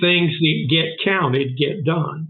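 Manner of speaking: slow and deliberate. Shown as a demonstration. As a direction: spell it out slowly so it sticks. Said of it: "What was the saying?".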